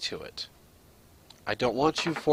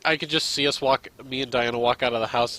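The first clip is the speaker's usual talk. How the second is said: ordinary talk, nothing marked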